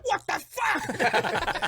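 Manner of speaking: high, drawn-out mock screech; imitated aghast wife. Wife appalled by husband's heckling, screeched. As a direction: screech it in disbelief.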